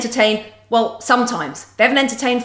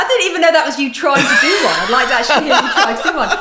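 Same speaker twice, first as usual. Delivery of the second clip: laughing, louder and higher; 3 semitones higher, 5 dB louder, faster than usual. Real, not acted. Co-host teasing the pundit, laughing loudly. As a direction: tease him through laughter.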